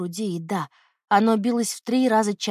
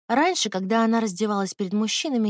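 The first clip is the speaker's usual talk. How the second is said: plain reading voice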